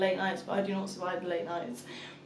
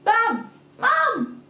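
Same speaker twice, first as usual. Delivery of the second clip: loud calls climbing higher each time; imitated toddler yell; 10 semitones higher, 10 dB louder, much slower than usual. Toddler calling mum who is right there, escalating yell. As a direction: mimic a toddler's escalating shouts.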